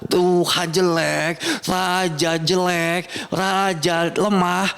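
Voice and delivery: drawn-out mocking taunts; acted jeering. Storyteller acting out the crowd mocking Jesus, jeering. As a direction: jeer slowly, stretching out the insults. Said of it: "diejeknya gimana?".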